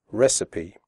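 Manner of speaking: recipe ending said sipi, RES-uh-pee; recipe said correctly. Shown as a demonstration. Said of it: "The ending C I P E is pronounced sipi".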